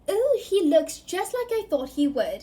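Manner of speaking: bright curious witch, lightly voiced; young witch. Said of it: "said the witch".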